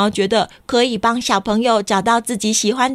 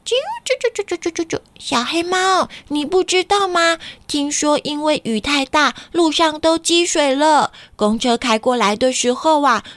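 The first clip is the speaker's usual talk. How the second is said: high, chirpy bird voice; little bird voice; 5 semitones higher than usual. Acted. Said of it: "听到旁边的小鸟说". (小鸟叽叽喳喳的声音)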